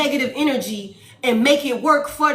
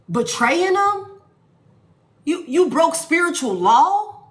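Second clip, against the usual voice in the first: angry spirit team; 7 semitones higher than usual. Acted. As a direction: scold them like furious ancestors. Spirit team scolding continues.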